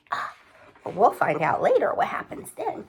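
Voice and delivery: usual speaking voice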